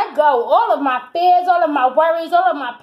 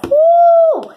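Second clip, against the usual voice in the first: very high, loud whoop; joyful whoop; 13 semitones higher, 7 dB louder than usual. Real, not acted. Reader whoops at the height of the reading. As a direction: let out a big joyful whoop.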